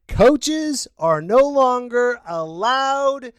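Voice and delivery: slow, spaced-out announcement; very slow, pointed delivery; emphatic repeat. Shown as a demonstration. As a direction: spell out the rule word by word. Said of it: "I'll say it again".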